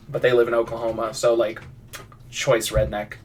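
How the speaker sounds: ordinary talk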